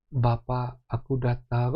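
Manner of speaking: low, measured, stiff address; mock solemn prayer. How not to pray to Bapak, mock solemn. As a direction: imitate an over-reverent servant at prayer. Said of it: "Bukan yang".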